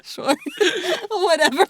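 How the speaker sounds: loud, high laughter through the word; can't hold it together; laughing. Real, not acted. Improv partner dissolves into loud laughter after the bit.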